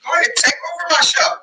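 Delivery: high, sing-song teasing; proud mom hamming up giving way; playful mock surrender. Real, not acted. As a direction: pretend to hand over the show. Mom mock-yielding her show.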